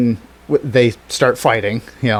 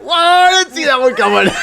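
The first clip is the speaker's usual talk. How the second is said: much higher, delighted surprise; amazed; 14 semitones higher, 3 dB louder than usual. Retold or imitated. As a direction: gush in awe at the twist. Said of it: "you're like".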